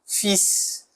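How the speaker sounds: "Fils" whispered, final S clear; whispered "Fils" with the S heard; whispered demo. Shown as a demonstration. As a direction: breathe "Fils" softly and sound the S. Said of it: "a consoante final".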